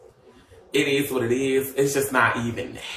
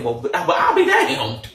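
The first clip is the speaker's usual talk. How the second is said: laughing disbelief; 14 semitones higher, 4 dB louder than usual. Retold or imitated. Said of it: "I said".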